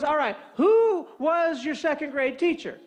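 high, slow, drawn-out quiz question; game show host. Retold or imitated. Alarm man poses question two, game show host. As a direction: announce the question grandly, stretching it out. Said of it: "we're playing some sort of game show here".

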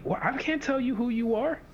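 high-pitched, amused protest; mock incredulous reply. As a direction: play the coach throwing the question back. Acting out coach's reply to client.

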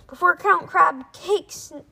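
high, loud, slow yell. Acted. Rip yelling a warning about the crab, loud. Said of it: "yelled Rip".

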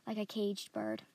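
ordinary delivery

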